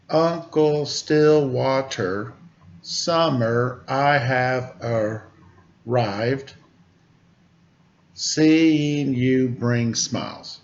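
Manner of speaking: slow, measured haiku reading; haiku recital. Shown as a demonstration. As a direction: savor each syllable like a poem. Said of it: "it's like a haiku".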